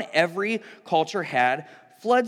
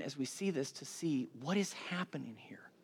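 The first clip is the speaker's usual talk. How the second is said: whisper; 5 semitones lower, 13 dB quieter than usual. Real, not acted. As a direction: drop into a hushed whisper. Sermon builds to revelation.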